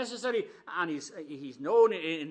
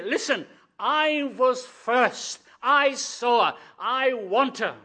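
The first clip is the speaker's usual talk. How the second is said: firm claim; 4 semitones higher, 7 dB louder, slower than usual. Acted. Boaz voiced claiming Ruth.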